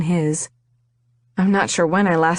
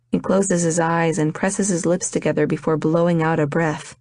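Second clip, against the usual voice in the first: plain reading voice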